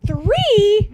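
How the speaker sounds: high, loud disbelieving yelp; shocked. Retold or imitated. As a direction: gasp out the word in shock. Phoebe shocked at triplets.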